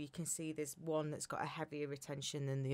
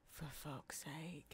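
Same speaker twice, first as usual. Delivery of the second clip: slow, hushed cursing under the breath; exasperated mum; 16 dB quieter, much slower than usual. Retold or imitated. Mum reading her medical book about the cream, quietly exasperated. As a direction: imitate quiet, weary disbelief.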